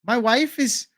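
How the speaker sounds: very high, hammed-up Irish accent; botched Irish accent. Streamer mimicking Ian Garry defending his wife, high botched Irish accent. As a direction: do a bad Irish accent that slips toward a film caricature. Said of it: "I think that sounds more like Fat Bastard".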